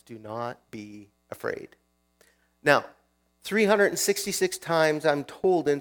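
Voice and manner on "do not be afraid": softer, slow and tender; gentle assurance. Retold or imitated. Jesus calming the disciples on the water, soft and slow. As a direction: lay it down slowly and softly. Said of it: "he says these awesome words".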